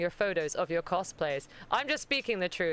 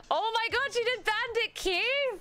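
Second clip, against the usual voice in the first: delighted squeal; 9 semitones higher than usual. Real, not acted. Host shrieking with delight at a Bandit Keith cosplay.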